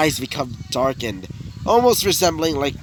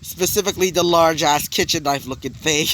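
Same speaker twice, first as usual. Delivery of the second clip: usual speaking voice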